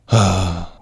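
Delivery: short acted sigh; exasperated sigh. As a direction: heave a put-on sigh of annoyance. General's exasperated reaction, retold.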